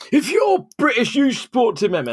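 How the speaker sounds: very high hammed-up insistence; mock patriotic bloke. Pub patron lecturing her about being British, mocking. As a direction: lampoon the indignant fan. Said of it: "pub man insisting".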